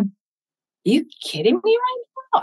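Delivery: high, incredulous cry of disbelief; retold disbelief. Widow recalling reading a psalm, stunned. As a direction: voice jumps up in amazed disbelief.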